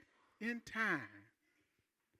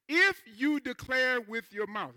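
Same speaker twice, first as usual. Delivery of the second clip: slow emphatic reading; 3 semitones higher, 4 dB louder, slower than usual. Shown as a demonstration. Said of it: "now watch this here".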